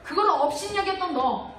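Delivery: loud, impassioned sermon delivery; fervent preaching. Acted. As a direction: preach it with full fire. Preaching at full force.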